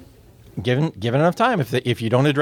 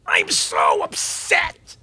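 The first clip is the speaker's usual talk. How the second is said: hammed-up dramatic lament, slow and low; mock melodramatic "I'm so upset"; overdone upset; 9 semitones lower, much slower than usual. Shown as a demonstration. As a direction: overplay being upset like an expressive. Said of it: "expressives are gonna be tempted to overdo".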